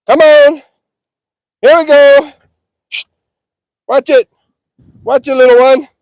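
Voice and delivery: loud high chanted cattle calls; calling the herd. Real, not acted. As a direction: drive them toward the grain.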